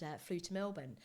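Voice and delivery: ordinary talk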